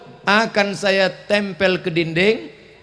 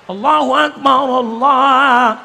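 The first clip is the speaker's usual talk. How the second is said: loud higher call to prayer; louder azan call; loud mock azan; 7 semitones higher, 6 dB louder than usual. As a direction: belt out the azan. Father doing azan to grown child.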